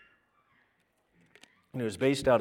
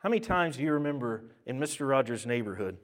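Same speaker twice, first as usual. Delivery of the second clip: normal talking voice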